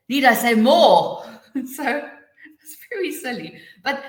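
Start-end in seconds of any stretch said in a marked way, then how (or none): 0.1-1.2 "more" high, loud and drawn out; stress on more. Shown as a demonstration. Teacher showing how stress changes meaning, stress on "more". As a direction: slowly swell onto "more". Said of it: "underlining different words".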